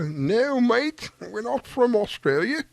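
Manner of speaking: high, slow mock Australian "No, mate"; imitating an Australian accent. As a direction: play the Aussie reply with a hammed-up Australian accent. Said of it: "that crazy accent, the Australian accent".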